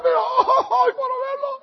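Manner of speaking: gushing mother-in-law. Retold or imitated. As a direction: gush like a weepy mother-in-law. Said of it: "mi suegra".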